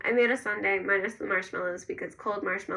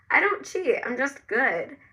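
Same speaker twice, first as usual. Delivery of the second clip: high, loud mock-indignant retort; playful protest; 4 semitones higher, 4 dB louder, slower than usual. A narrator's reading. Narrator answering Ezra's teasing about cheating, in playful protest. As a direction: protest playfully, voice jumping up at first.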